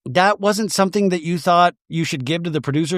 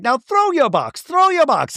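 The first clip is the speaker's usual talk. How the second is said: much higher, pushy mock voice; high Braun impression; 11 semitones higher than usual. Host voicing Braun pushing Angie, a much higher, pushy mock voice. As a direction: urge her on in a high squeaky voice. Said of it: "Braun was like".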